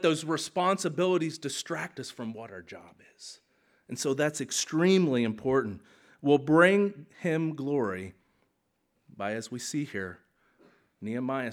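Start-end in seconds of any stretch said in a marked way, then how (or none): none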